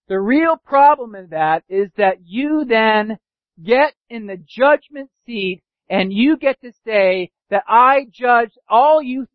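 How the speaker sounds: normal talking voice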